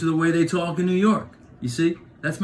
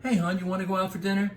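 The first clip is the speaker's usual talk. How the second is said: breezy West Coast; 6 semitones higher, 4 dB quieter, faster than usual. Retold or imitated. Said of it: "But on the West Coast, they'd be like".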